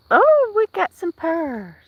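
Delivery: high, slow delighted exclamation. Real, not acted. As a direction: marvel at the purring cat. Delighted at the cat purring.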